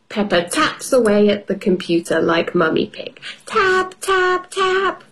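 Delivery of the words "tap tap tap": tapping sound. Acted. Typing sound imitated.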